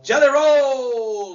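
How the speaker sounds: drawn-out, high, loud name reveal; excited announcement. Real, not acted. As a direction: belt out the name like a ring announcer. Announcing the celebrity partner name.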